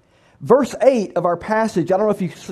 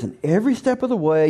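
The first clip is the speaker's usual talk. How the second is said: usual speaking voice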